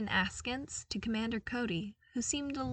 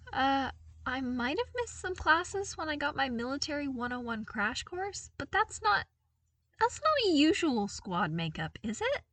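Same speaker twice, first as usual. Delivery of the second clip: higher, unsure and self-conscious; awkward, trailing off; 8 semitones higher than usual. A narrator's reading. Luke to his new squad, awkward and trailing off. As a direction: doubts himself more with each word. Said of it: "He trailed off at the last words".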